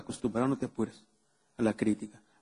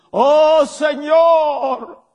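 much higher, much louder wailing cry of oh Lord; mock desperate prayer; 10 semitones higher, 10 dB louder, much slower than usual. Preacher mocking how people pray, loud higher wailing cry. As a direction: ham up a panicked prayer. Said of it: "las mismas oraciones así".

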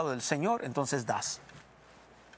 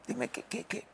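whispered prayer; 5 semitones higher, 8 dB quieter than usual. Acted. Humble prayer to the Lord.

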